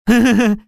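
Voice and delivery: high, short acted giggle; laugh. A narrator's reading. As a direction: laugh lightly in character. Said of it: "Засмеялся он". (высокий смешок)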